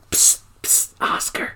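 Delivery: hushed whisper to get attention; secretive whisper. Retold or imitated. Angela secretly calls Oscar over, secretive whisper. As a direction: whisper it softly, as if summoning in secret.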